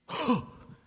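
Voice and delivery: sharp mock gasp of shock; hammed-up audible gasp. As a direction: gasp as if scandalized. Shocked reaction to Jesus' words.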